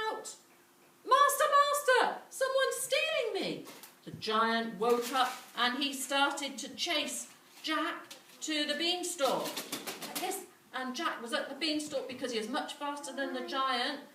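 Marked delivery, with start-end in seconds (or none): 1.1-3.7 high, loud magical harp crying for help; harp calling out. Acted. The harp calls out. 9.4-10.4 whispered aside. A narrator's reading.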